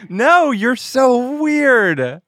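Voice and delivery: high, drawn-out exclamation; incredulous teasing. Real, not acted. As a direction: stretch it out in mock disbelief. Teasing outburst at co-host.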